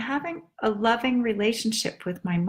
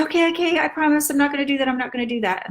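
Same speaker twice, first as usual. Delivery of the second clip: higher, fast, eager to appease; hurried mother promise; 5 semitones higher, much faster than usual. Retold or imitated. Mother hastily promising her daughter she will stop, fast and higher. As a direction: rush the promise like a caught mother.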